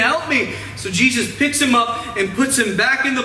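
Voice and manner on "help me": mild mock yell for rescue; mock scream. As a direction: imitate a panicked plea, pitched up. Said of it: "he starts screaming".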